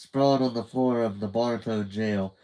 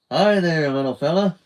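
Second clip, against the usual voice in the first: louder, higher hearty greeting; cheerful man; 6 semitones higher, 8 dB louder than usual. A narrator's reading. Cheerful man greeting little Judy brightly. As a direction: greet the kid with a big salesman cheer.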